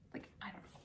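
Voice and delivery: whispered, embarrassed aside; self-conscious whisper; sheepish whisper. Real, not acted. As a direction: murmur it, a little shy after reading. Shy after reading poem.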